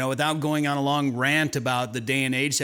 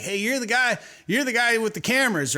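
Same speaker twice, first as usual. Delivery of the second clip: put-on Southern accent; 8 semitones higher than usual. Angler hailing the guy with the cameras, Southern accent. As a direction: call out like an imitated Southerner. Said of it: "they have a Southern accent all of a sudden".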